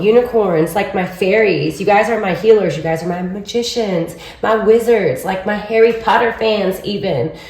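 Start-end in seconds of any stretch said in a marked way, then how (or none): none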